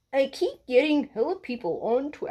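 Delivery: high, far-off frog puppet voice, soon dropped; high Kermit impression of a commenter. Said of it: "best Kermit the Frog voice".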